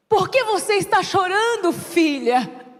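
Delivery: loud, slow, solemn proclamation; divine voice on phone. Retold or imitated. God calling a crying daughter by phone, divine proclamation. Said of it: "a voz disse assim".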